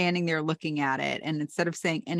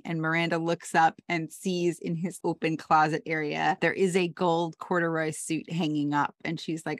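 ordinary talk, nothing marked

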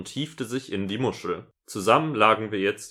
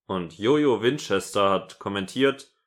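ordinary talk

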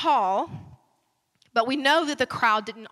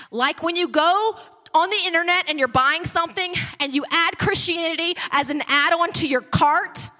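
preaching; 5 semitones higher, 5 dB louder than usual. Acted. Mocking consumer attitude, preached.

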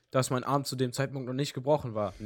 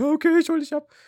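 much higher, louder mock mother; mum voice; 14 semitones higher, 8 dB louder, faster than usual. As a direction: squeak it like a fussing mum. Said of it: "Die so".

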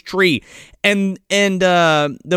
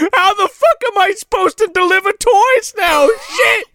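high-pitched comic tantrum; pissed-off Santa; 4 dB louder than usual. Retold or imitated. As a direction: rant like a furious, frantic Santa. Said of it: "And Santa is fucking pissed".

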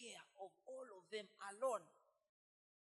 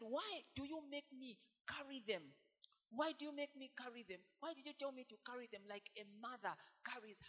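upset complaint; 4 semitones higher, 3 dB louder than usual. Acted. Moses complaining to God about the people, upset protest. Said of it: "He was so upset".

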